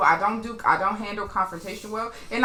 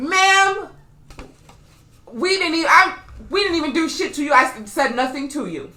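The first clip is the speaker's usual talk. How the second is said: raised, higher voice of protest; indignant; 5 semitones higher, 5 dB louder than usual. Retold or imitated. Rider defending herself to the woman on the bus, indignant. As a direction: retell an angry comeback, loud and high.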